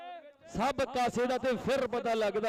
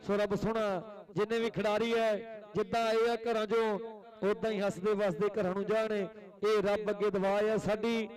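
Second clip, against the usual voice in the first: kabaddi commentary; 5 semitones lower than usual. Real, not acted. Prayer for the players.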